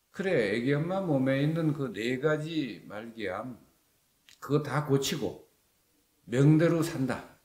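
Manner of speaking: slow, emphatic declaration of a verdict; deliberate, weighty assurance; retold pronouncement. Retelling the healer's pronouncement.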